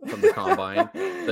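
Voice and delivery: laughing through the story. Real, not acted. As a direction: giggle while telling the funny bit. Funny tiny hands story.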